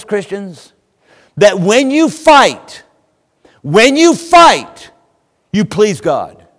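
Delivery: high and loud preaching, pressing every line; full-force preaching. Acted. Preacher exhorting his congregation, fiery and loud. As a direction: preach it hard to the pews.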